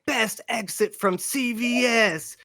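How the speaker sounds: high, slow mock announcer. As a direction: announce it like a prize.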